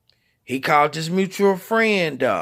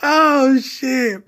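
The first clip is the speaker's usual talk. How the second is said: high exclamation, recovering from real laughter; laughing to tears; 8 semitones higher, 3 dB louder than usual. Real, not acted. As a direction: catch your breath after cracking up. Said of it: "like, literally crying on".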